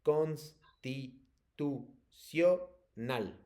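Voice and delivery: very slow, broken into separate syllables. Shown as a demonstration. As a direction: sound it out for a pupil, pausing after each syllable. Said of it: "La voy a pronunciar".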